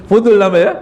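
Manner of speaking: fast laughing punchline. Real, not acted. Preacher laughing as he names the nosy habit. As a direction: laugh out the verdict quickly.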